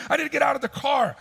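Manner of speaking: high, fast mock shout; hammed-up angry outburst; nervous and angry. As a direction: imitate a frantic man demanding out. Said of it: "he's angry. He's like".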